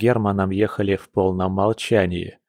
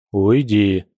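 gloomy. A narrator's reading. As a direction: grumble it out sullenly. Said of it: "мрачно произнёс волк".

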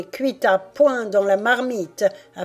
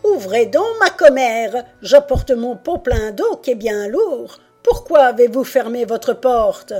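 loud, raised call through a door; crying out; 4 semitones higher, 7 dB louder than usual. A narrator's reading. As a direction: call out to be let in. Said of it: "cria à travers lui". (cri à travers la porte)

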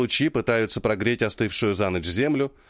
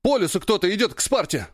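high breathless alarm; urgent panting report; 7 semitones higher than usual. A narrator's reading. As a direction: blurt it out out of breath. Патрик прибежал с вестью. (запыхавшийся тревожный доклад)

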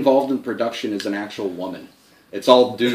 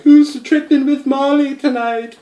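mock British accent; 13 semitones higher, slower than usual. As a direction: ham up a fake posh British accent on stage. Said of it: "in her faux affected British accent".

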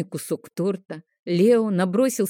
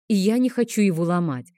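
usual speaking voice

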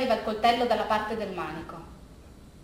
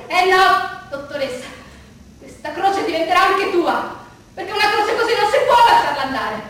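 loud, rising furious threat, higher and louder; furious Rita; 7 semitones higher, 7 dB louder than usual. Retold or imitated. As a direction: hurl the curse back at the doctor. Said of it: "non l'ho mai vista furiosa". (Rita minaccia furiosa la dottoressa, gridando)

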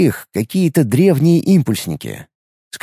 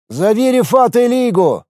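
calling out; 10 semitones higher than usual. A narrator's reading. Calling out to a companion.

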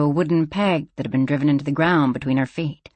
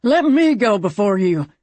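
higher, louder character voice; the cougar pressing to go first; 4 semitones higher, 3 dB louder than usual. A narrator's reading. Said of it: "the cougar said to one of the others".

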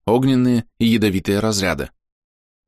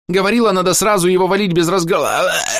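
panicked scream; 11 semitones higher, 3 dB louder than usual. A narrator's reading. Dying player screams.

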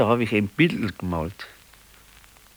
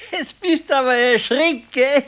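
much higher, gleeful teasing; 4 dB louder than usual. Acted. Husband teases his wife about her fright, high and gleeful. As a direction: gloat gleefully that you scared her.

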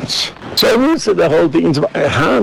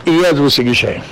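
usual speaking voice

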